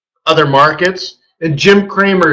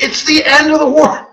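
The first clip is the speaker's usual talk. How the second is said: higher, frantic mock doom cry; panicked Jim Cramer; 10 semitones higher than usual. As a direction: wail that the world is ending. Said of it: "And he's like".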